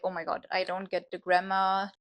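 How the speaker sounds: ordinary delivery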